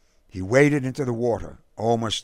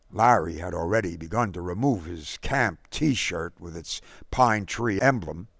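ordinary delivery, nothing marked

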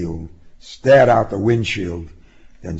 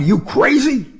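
higher and louder; loud outburst; 5 dB louder than usual. A narrator's reading. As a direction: yell it in disbelief. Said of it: "he cried".

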